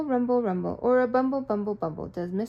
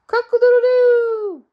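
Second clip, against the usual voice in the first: high, loud, slow rooster crow; 14 semitones higher, 14 dB louder, slower than usual. Acted. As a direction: crow the sun up. Rooster crows at sunrise.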